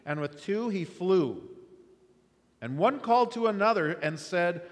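measured, solemn Bible reading; scripture reading. Acted. Preacher reading Isaiah to congregation, solemn. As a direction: read the passage with weight.

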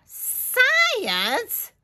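loud, indignant complaint starting very high; put-out queen, high then quick; fussing. Acted. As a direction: fuss in outrage, then hurry on. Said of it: "fussed the queen".

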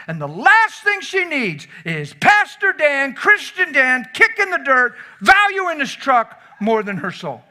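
high, loud and slow, building indignation; preacher mocking a careless pastor; rising preacher shout. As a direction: lift it into a full sermon cry.

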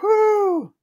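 high "whoo" settling down in relief; acted relief. Diners think the gunman has saved them, acted relief. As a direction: imitate a crowd sighing with relief. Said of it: "everyone's relieved".

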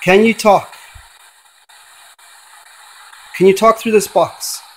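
summoning a dead singer through a spirit box; calling out to a spirit. Acted. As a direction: call into the void, hoping she answers.

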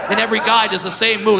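normal talking voice

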